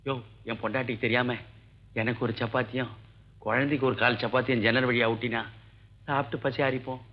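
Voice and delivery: low and soft, keeping it secret; hushed pleading. Acted. Husband secretly begging food from the doctor, hushed and low. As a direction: beg quietly so the wife won't hear.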